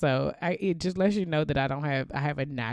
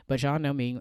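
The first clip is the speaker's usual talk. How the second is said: normal talking voice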